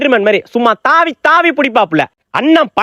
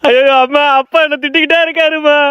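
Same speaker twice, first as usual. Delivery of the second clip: put-on whiny child's complaint; mock crying; 3 semitones higher than usual. Son told off by dad, mock-tearful wail. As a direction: pretend to blubber to mum. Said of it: "அழுது கூட தொலைஞ்சிருப்பா".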